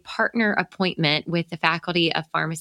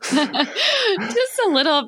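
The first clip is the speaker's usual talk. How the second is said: teasing through laughter; much slower than usual. Real, not acted. Host teases guest about accent.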